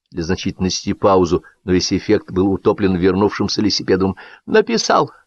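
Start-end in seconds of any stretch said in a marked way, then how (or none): 4.5-5.1 high, barked repeated words; barking voice. A narrator's reading. Returning guest barks at the table in a high voice. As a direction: bark it out in a raised voice. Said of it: "произнёс он лающим голосом".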